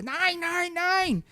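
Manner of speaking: lightly put-on German shout; a hint of a German soldier; German officer voice. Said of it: "je l'imagine". (voix d'Allemand légèrement imitée)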